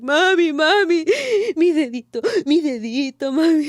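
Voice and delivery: drama queen. Retold or imitated. Arriving with her mom, drama queen whine. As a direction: ham up the hurt little girl crying for mommy. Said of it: "I was a drama queen".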